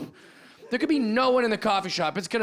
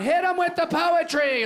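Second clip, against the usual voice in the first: higher, urgent hipster command; mock battle order; 10 semitones higher, 3 dB louder than usual. Hipster commander orders a poetry counterattack, higher and urgent. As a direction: call for the poetry like a general. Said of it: "They're going".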